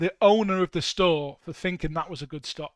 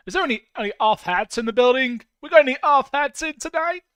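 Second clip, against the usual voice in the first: loud, high call to a whole room; mock calling out; 7 semitones higher, 9 dB louder than usual. Asking the building for off hats, mock calling out. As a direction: play at hollering a question round the building. Calls out to an imagined crowd.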